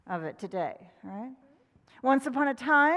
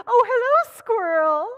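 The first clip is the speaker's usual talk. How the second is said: much higher, louder chirpy hen voice; hen greeting; 11 semitones higher, 12 dB louder than usual. Acted. Hen greeting Squirrel, high and loud. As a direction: sing out a cheery hello. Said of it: "She said".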